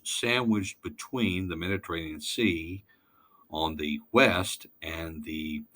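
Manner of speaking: ordinary talk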